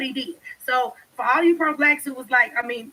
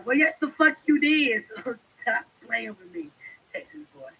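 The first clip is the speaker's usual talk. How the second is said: ordinary delivery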